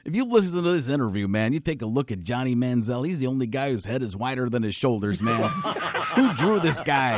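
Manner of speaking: a pumped-up football coach; hyped coach raving about a quarterback; Jon Gruden. Retold or imitated. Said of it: "the John Gruden was great".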